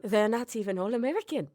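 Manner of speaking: retry the line in a wobbly British-Australian accent; attempted British-Australian accent. Shown as a demonstration. Host playfully retries a line about the cast, fake British-Australian accent. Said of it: "a hint of Australian or not".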